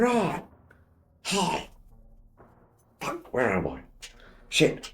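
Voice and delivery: mock drunk waking up confused; a hint of a bleary drunk; town drunk. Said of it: "You're drunk again, mate".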